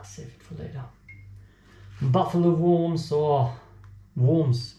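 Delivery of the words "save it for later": whisper. Pretend. Echoed whisper of previous line.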